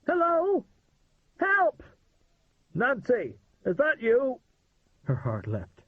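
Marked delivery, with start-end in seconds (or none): 0.0-1.8 high, loud cry for help; shouting for help. A narrator's reading. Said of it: "She shouted". 2.8-4.4 higher, louder call through the door. A narrator's reading. Calling through a locked door.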